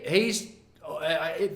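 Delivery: ordinary delivery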